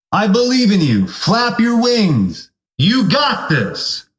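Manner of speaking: slow, lifted pep talk from the wise eagle; high encouraging eagle. Acted. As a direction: draw out flap your wings slowly.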